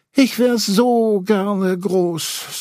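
high, slow, wistful sigh; long for it with a sigh; sighing Piglet. A narrator's reading. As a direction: Piglet wishes to be tall, sighing slowly. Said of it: "seufzte Ferkel".

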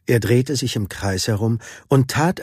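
ordinary delivery, nothing marked

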